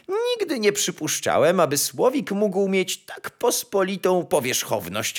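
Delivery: high-pitched pompous disbelief; astonished. A narrator's reading. Chamberlain judging the nightingale's plain looks, high astonishment. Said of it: "spytał zdumiony szambelan".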